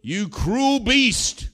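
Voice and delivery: high, loud, drawn-out accusation; yelled out. Acted. Someone in the crowd yelling at the preacher, outraged shout. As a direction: shout it slowly across the room, outraged. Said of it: "somebody yelled out".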